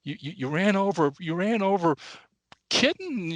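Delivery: stammer, then squeak the last word up high; shocked old lady. Acted. Old lady to driver who killed her cat, rising dismay.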